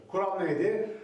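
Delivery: normal talking voice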